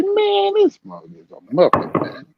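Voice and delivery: mock the fighter with a high squeal; mock disbelief. Boxing podcast host mocking a fighter, high incredulous squeal. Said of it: "I was like".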